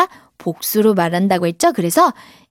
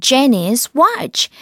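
very slow, clear repeat; 2 semitones higher, much slower than usual. Shown as a demonstration. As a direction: repeat slowly for kids.

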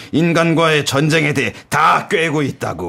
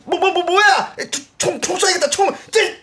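much higher, stammering panic; panicked stammer. Acted. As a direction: panic and fumble for the gun. Jones panics under attack.

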